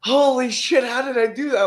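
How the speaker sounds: amazed disbelief. Retold or imitated. Drunk cousin marveling at passing the test, amazed. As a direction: drunk cousin stunned he passed. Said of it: "He's all like".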